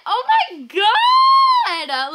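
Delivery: high, loud oh my God; shocked shout. Real, not acted. Kid seeing an Echo Dot gift, shocked shout. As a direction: yell in disbelief.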